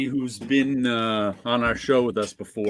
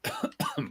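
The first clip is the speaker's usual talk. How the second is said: sudden sharp cough; choking cough; 8 dB quieter than usual. Real, not acted. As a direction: cough like something went down wrong. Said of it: "Choking on nothing".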